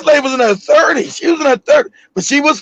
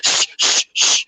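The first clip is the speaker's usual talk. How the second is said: repeated soft, voiceless shushes; shushing; 6 dB quieter, slower than usual. Retold or imitated. Brother hushes brother about the secret, shushing. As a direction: shush him again and again. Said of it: "He said".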